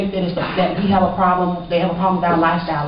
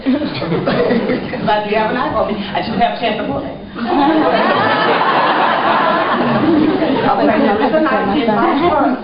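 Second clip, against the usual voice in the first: laughing through the words at her own story; giggling out the confession about the knife; 6 semitones higher than usual. Real, not acted. Telling the audience about her knife, laughing.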